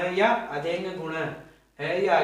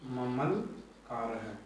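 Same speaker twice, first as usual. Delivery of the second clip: very slow repeat; 5 semitones lower, 8 dB quieter, much slower than usual. Shown as a demonstration. Teacher repeating ममलकार है for students, drawn out very slowly. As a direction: stretch ममलकार है much slower, each syllable drawn out. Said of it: "मम plus लिकार है".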